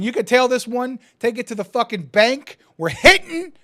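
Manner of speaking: loud and rising, high by the end; hyped-up boast. Real, not acted. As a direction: sell it like a sure thing. Hyping his confident parlay.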